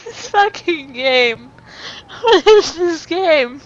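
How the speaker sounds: exasperated. Real, not acted. Player baffled by the game.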